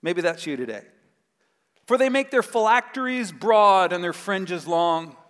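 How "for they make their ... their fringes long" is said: scripture reading. Acted.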